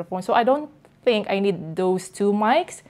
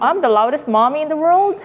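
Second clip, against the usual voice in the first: mock surprise; 6 semitones higher, 6 dB louder than usual. Real, not acted. Mom reacting to being called loudest.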